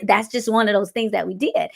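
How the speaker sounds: ordinary delivery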